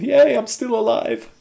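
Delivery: high-pitched little yay of celebration; mock cheer. Cheers own survival.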